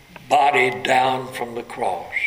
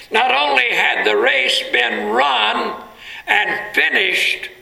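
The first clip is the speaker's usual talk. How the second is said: ordinary talk, nothing marked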